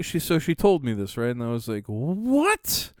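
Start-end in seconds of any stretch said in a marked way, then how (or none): 1.9-2.9 rising, higher and higher; squeaky shocked what the fuck; outraged disbelief. Retold or imitated. Retold outrage, very high.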